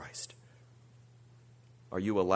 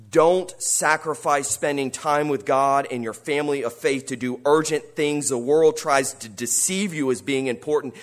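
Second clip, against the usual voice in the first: ordinary talk, nothing marked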